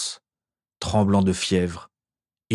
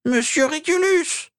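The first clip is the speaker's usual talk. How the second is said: elf cries out. Acted. Elf sees master fall among corpses, high elf cry. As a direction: scream for your master as a terrified elf. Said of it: "s'écria créature".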